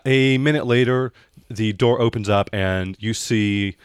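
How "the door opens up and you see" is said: ordinary talk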